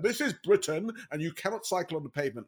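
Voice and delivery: lightly voiced little Englander; mock-proud lecture from a stuffy Brexiteer.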